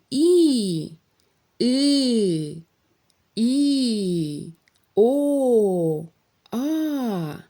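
each vowel called out clearly and loudly, one by one; vowels for repeating. Shown as a demonstration. Teacher to young pupils, calling vowels to repeat. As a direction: call out each vowel crisply for pupils to echo. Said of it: "Я говорю, а ви повторюєте".

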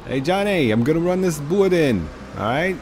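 put-on high, loud Boston accent. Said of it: "The Boston sound".

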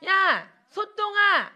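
high, drawn-out taunting call; mock teasing. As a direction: imitate kids calling out a teasing nickname. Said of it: "이러고 놀리는 친구들이 많았어요".

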